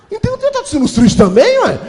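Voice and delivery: put-on high, laughing kid voice; mock childish reasoning. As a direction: giggle it out like a naive kid. Kid convincing himself.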